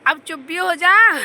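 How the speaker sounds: loud, slow scolding yell at her daughter; slow loud scolding mother's shout; mom's angry shout. Retold or imitated. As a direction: bark it out like a fed-up mother on a bus. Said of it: "मम्मा ने इतनी तेज चिल्ला के बस में बोला".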